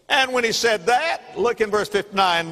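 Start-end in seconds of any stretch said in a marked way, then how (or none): none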